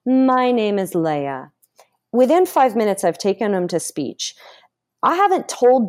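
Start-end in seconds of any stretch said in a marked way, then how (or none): 0.0-1.5 slow sentence opening on a long hummed m; resonant voice exercise. Shown as a demonstration. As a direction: carry the hum smoothly through a slow, connected phrase. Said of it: "do a sentence".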